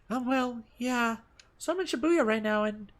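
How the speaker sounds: put-on high, quick young woman voice; girlfriend voice. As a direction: sound apologetic and girlish. Rumi voiced on phone.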